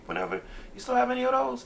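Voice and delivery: squeaky imitation of an eager fan; high mock fan voice. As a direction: play a hopeful fan, voice jumping high. Imagined fan asking.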